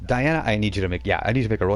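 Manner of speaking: ordinary talk, nothing marked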